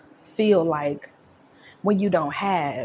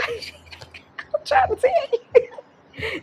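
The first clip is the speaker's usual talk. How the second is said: much higher, laughing through the words; laughing hard; 3 dB louder, slower than usual. Real, not acted. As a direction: crack up as you speak. Laughing over reading.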